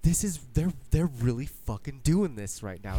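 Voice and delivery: excited disbelief. Retold or imitated. Fan watching the show, stunned and thrilled. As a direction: blurt it out in shocked excitement. Excited quote of own reaction.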